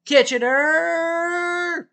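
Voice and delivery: long, high stretched-out yell of Kitchener!; slow booming hometown taunt; shouted taunt. Acted. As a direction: hold Kitchener! out like a crowd chant. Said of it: "so that's my taunt".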